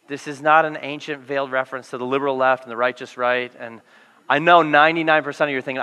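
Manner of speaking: ordinary talk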